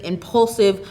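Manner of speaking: ordinary delivery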